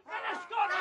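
high, sharp shouted command; shouting order. Acted. Shouting at Naser to drop the spray, high and urgent. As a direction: order him to drop it, yelling.

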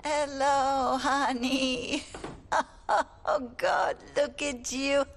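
weepy, emotional welcome; tearful greeting. Acted. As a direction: greet the grandchild while breaking into tears. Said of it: "why are you crying?".

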